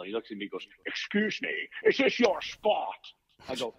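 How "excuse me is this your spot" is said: high, drawn-out Scottish film-star impression; slow put-on Scottish voice, breathy start; Sean Connery. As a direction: challenge him slowly as a Scottish screen legend. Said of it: "Mister Connery".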